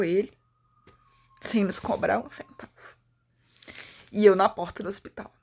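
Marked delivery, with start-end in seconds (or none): none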